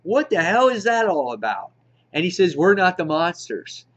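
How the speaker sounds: normal talking voice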